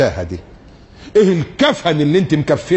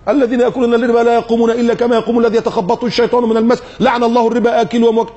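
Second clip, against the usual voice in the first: recitation style; 7 semitones higher, faster than usual. Acted. Reciting verse and hadith.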